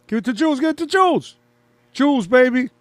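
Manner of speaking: loud, high shouts at the play; excited cheering. Real, not acted. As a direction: urge the team on, voice jumping up.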